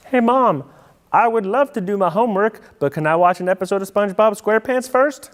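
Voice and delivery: put-on butter mom up like a kid; sweet-talking kid.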